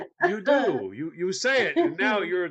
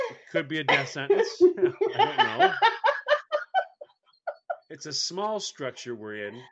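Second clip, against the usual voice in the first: ordinary delivery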